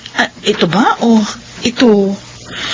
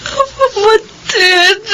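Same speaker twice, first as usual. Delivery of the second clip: very slow, high, tearful call; crying out; 5 semitones higher, much slower than usual. Acted. Widow weeping to her younger sister, crying. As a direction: sob the word out long.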